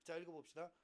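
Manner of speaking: ordinary delivery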